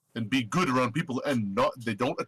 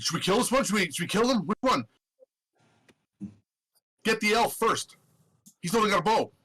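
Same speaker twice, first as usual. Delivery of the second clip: higher, chattering goblin voices; eager goblins; 7 semitones higher than usual. Acted. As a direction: sound like an eager pack of goblins. Goblins asking about the intruders.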